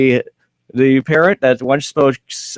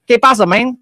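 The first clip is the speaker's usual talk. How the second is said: put-on higher, playful Spanish-accented parrot voice; parrot speaking Spanish; 10 semitones higher than usual. Host imitates returned parrot, a lightly Spanish-accented squawk. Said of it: "he's speaking Spanish".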